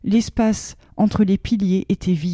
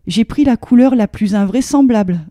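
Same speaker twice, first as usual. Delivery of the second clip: ordinary delivery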